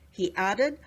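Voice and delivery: plain reading voice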